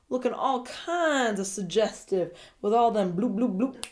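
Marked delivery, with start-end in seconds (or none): none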